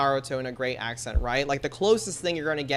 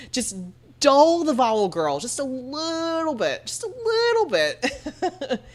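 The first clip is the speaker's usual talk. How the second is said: high, slow, drawn-out sassy coaxing; sassy girlfriend voice; 10 semitones higher, 4 dB louder, slower than usual. Pretend. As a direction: tease her along like a bestie. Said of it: "It's like".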